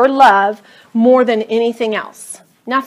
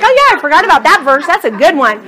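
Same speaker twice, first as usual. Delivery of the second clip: excited recall; 5 semitones higher, 6 dB louder, faster than usual. Retold or imitated. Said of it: "I'm like".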